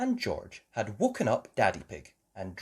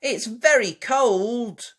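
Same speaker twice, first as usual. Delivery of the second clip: put-on high, loud, slow child's complaint; sighed complaint; 12 semitones higher, 7 dB louder, much slower than usual. Child complaining of the cold, sighing. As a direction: draw it out with dismay. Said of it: "sighed Peppa".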